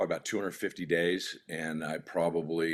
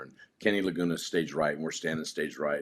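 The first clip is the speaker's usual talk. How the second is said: usual speaking voice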